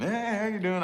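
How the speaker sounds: high, mock-cheery hello; forced friendly greeting. Shown as a demonstration. Practising small talk at the gas station, mock cheery. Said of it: "I gotta practice".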